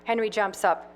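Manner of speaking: plain reading voice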